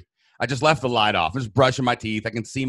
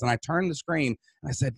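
normal talking voice

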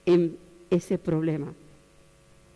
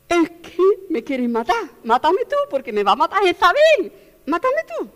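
desperate plea; 9 semitones higher, 3 dB louder than usual. Acted. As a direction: beg to be killed, voice climbing high. Elijah begging God for death.

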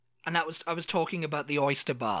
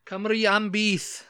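Welsh rallying cry; 6 semitones higher, slower than usual. Pretend. Welsh slogan, put-on voice.

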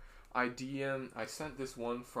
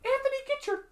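very high, loud falsetto scolding; squeaky cartoon voice; 14 dB louder than usual. Teasing co-host tells Anthony off, in squeaky cartoon falsetto. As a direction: shriek it like a cartoon mascot.

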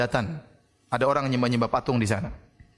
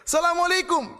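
loud salam shout. Retold or imitated. Preacher retells the policeman greeting an idol, shouting. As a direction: bellow the greeting at the statue to provoke. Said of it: "Saya sengaja keras".

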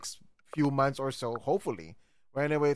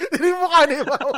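laughing; 11 semitones higher, 10 dB louder than usual. Real, not acted. Laughing about a frozen call.

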